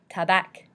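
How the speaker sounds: French tabac ending in a k sound; final C sounded. Shown as a demonstration. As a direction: say tabac with the C pronounced. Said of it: "ends with a C, which we pronounce".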